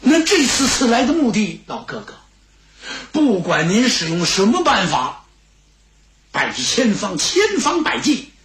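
urgent plea with sudden high peaks; determined Xue Gang. Acted. Fugitive asking the steward to smuggle him into Chang'an, determined.

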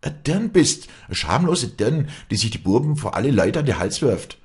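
high, loud furious accusation; screamed insult. A narrator's reading. Farm woman accusing rival before everyone, screaming. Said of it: "schrie sie".

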